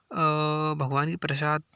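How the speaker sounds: ordinary talk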